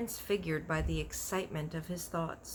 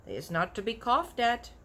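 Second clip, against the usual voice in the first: giant's voice; 7 semitones higher, 4 dB louder than usual. A narrator's reading. BFG voiced in a story.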